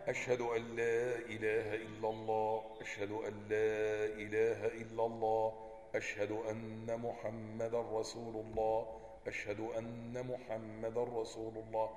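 soft, low chanted testimony. Shown as a demonstration. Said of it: "تخفض بها صوتك".

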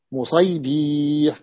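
slow, drawn-out مصيبيح; slow demo. Shown as a demonstration. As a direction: spell مصيبيح out syllable by syllable. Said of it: "teacher slowly sounds out diminutive".

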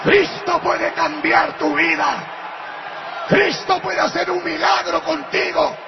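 slow, loud proclamation of Christ; shouted preaching. Retold or imitated. Driver preaches to the weeping passenger in a slow shout. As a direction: thunder the message slowly.

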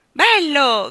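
loud, high delighted shout; delighted cry. Acted. King clapping at the jester's song, shouting with delight. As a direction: cry out with glee. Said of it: "gridò il re battendo le mani".

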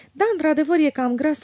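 mildly pensive agreement; thoughtful admission. A narrator's reading. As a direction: concede it as if just considering it. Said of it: "recunoscu ea gânditoare".

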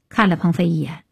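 ordinary delivery, nothing marked

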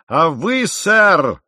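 loud, high outburst; captain exploding at his officer; enraged shout. A narrator's reading. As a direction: cut him off with a furious yell. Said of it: "закричал капитан голосом, какого я никогда у него не слышал".

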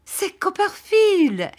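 high, slow, delighted recognition; drawn-out cry of joy at an old friend; joyful exclamation. A narrator's reading. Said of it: "s'écria Traddles".

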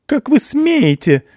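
shrill-high, loud, slow outraged scream; screaming woman. A narrator's reading. Mother screams in outrage at the officer, very high and loud. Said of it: "закричала женщина".

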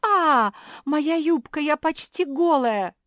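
very high, loud cry of dismay; panicked shriek. A narrator's reading. Kuki discovers her skirt has vanished, shrieking in panic. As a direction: shriek in horror, drawing out the first cry.

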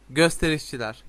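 usual speaking voice

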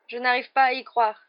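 high, distressed lament; upset, plaintive disbelief; moaning. A narrator's reading. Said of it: "gémit Hermione".

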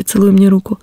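ordinary delivery

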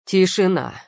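roaring command for silence. A narrator's reading. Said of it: "взревел король".

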